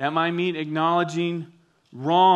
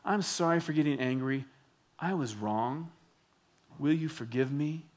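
softer, humble admission of fault; sincere apology; 3 semitones lower, 6 dB quieter than usual. Shown as a demonstration. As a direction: model a quiet, earnest apology.

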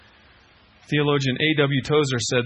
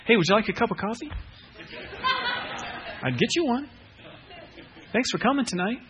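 higher, casual mock-friendly patter; breezy fake politeness; 6 semitones higher, 3 dB quieter than usual. Preacher who just kicked a listener, breezily polite. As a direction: brush it off with cheery, oblivious courtesy.

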